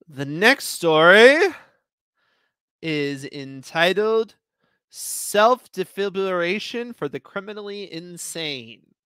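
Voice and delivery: slow, deliberate title read; announcing a new story title, slow and deliberate; title announcement. Acted.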